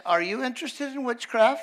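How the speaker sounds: lifted, sugary question from the witch; sweet witch voice. Retold or imitated. Witch greets the preacher in her shop, sugary high. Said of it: "She said".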